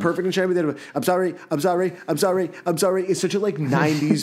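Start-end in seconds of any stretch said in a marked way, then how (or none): 0.9-3.1 higher, crammed "I'm sorry, I'm sorry" in a light imitation of the singer; imitating a pop-punk singer's rushed finale. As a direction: squeeze too many syllables into the beat. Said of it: "The way [name] delivers the "I'm sorry".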